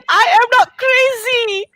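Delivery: high voice breaking, slow; choked-up relief; real tears on the words; crying. Real, not acted. Same phrase said crying.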